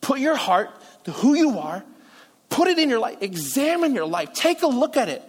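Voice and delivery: heartfelt, insistent exhortation; emotional pleading. Acted. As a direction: plead personally, pitch lifting on each appeal. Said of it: "a pleading ... an emotional type of".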